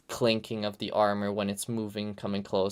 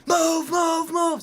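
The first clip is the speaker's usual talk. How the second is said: high, loud shouted command; full-out urgent battlefield-style shout; committed yell; 7 dB louder, slower than usual. Shown as a demonstration. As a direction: yell it from back off the mic. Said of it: "stand back from the microphone and yell".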